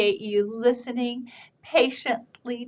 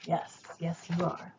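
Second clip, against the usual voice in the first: usual speaking voice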